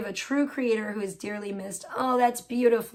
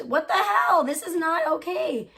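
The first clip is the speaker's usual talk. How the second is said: indignant disbelief; 6 semitones higher than usual. Real, not acted. Reacting to the unfair snub.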